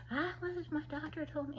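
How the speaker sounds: high, soft mock of a chatterbox; chattering woman imitation. Recalling the woman who wouldn't stop talking, high chattery imitation. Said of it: "She was just like".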